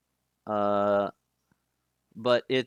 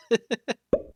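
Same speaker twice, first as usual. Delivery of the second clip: short, high pulsed laugh; light self-mocking giggle; real chuckle; 12 semitones higher, 3 dB louder than usual. Real, not acted. Streamer joking about mispronouncing words, giggling. Laughing at own bad pronunciation.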